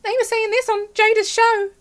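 high, whiny tattletale voice; mock snitch. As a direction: mimic a sneering informer. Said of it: "snitch and go".

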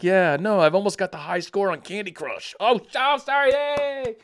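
bored senator mockery. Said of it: "She's like".

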